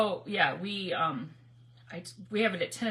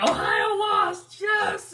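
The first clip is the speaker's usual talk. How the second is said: slow, higher triumphant yell; gleeful shout; 12 semitones higher, 7 dB louder, much slower than usual. Retold or imitated. Husband bursts from bedroom cheering Ohio's loss, shouting. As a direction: crow over the rival's loss. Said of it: "He's like".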